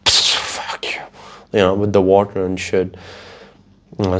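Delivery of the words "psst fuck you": hissed, breathy spurts like a blowhole; mock whale whisper; whispered whale cussing. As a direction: spit it out like a whale spraying water.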